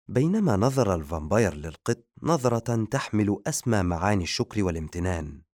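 ordinary delivery, nothing marked

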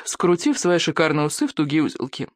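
ordinary delivery

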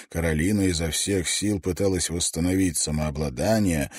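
ordinary delivery

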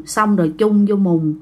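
plain reading voice

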